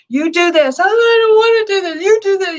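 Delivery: high, loud mock argument with a child; hammed-up shouting match with a stubborn child; mock quarrel. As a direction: ham up a parent and whiny kid squabbling. Parent and child quarrel retold.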